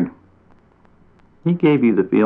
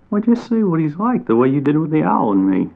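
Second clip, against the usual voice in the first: much higher, bright helpful chipmunk; a cheerful small animal setting him straight; a chipmunk giving kindly advice, higher voice; 7 semitones higher than usual. A narrator's reading.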